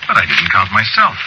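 usual speaking voice

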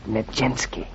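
hushed, soft whisper; tiptoe-quiet reply. Acted. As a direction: whisper the name back quietly. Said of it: "we don't have to whisper anymore".

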